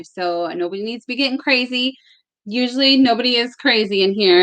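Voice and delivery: ordinary delivery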